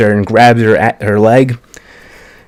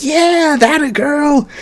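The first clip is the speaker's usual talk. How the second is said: much higher, gleeful mock cheer; excited cheer; slower than usual. Krista cheering Ymir on as the tower falls: high, gleeful mock cheer. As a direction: squeal it like a proud fan. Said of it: "Krista's just screaming".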